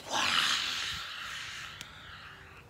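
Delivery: long whooshing exhale; noisy, airy whoosh; fire breath. A narrator's reading. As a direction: blow out a big dragon blast of fire. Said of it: "breathed out so much fire".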